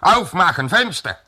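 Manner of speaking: high-pitched German command; calling out. A narrator's reading. Soldier demands the window be opened, high called-out order.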